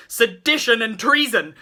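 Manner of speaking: outraged loud repeat; indignant repeat. A narrator's reading. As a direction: hammer the word treason home. Repeats phrase louder, indignant.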